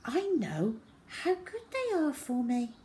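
put-on high, piping little boy; virtuous small-child voice; goody-goody child. A narrator's reading. Perfect child shows off virtue.

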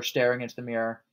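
ordinary delivery

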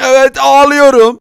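loud, tearful cartoon wail; acted crying. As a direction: wail it out in mock sobs. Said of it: "Sen ağlıyor musun?".

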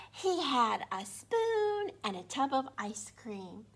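plain reading voice

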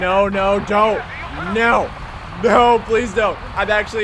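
high, loud cries of refusal; urgent pleading. Real, not acted. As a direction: beg loudly for it to stop. Pleading as game transmission interrupts.

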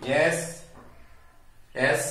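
ordinary talk, nothing marked

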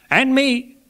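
very high, loud little interjection; wife chiming in. A narrator's reading. Clergyman's wife interposes to be included, high piping voice. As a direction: pipe up eagerly in a high voice.

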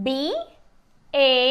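very slow, letter by letter; spelling out. Shown as a demonstration. Teacher spelling a word for learners, slowly letter by letter. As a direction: spell it with long gaps between letters. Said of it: "ఎలా పలుకుతాయో చూద్దాము".

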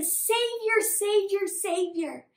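acted acclaim, easing on the last; crowd yelling. Teacher acts crowd hailing their Savior, excited yelling. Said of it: "They were yelling at Jesus".

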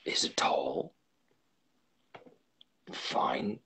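slow, halting whisper with long pauses; hushed, haltingly whispered description. A narrator's reading. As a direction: whisper it confidentially, word by word. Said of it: "began to whisper".

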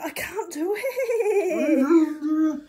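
slow high laughing wail; whiny wail. Acted. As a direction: wail in mock despair. Wailing, jar won't open.

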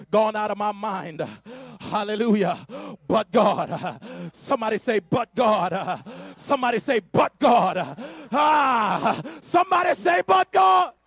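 rousing Pentecostal sermon voice; full-force preaching. Acted. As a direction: preach it to the rafters, calling the church to answer.

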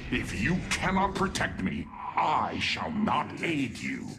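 cold threat. Acted. Angry threat to Artanis.

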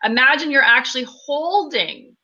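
unhurried guiding voice with long pauses between phrases; guided meditation. Acted. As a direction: lead a group through breathing and visualization, leaving room after each phrase. Leads guided meditation after plain intro.

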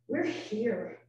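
ordinary talk, nothing marked

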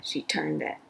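ordinary delivery

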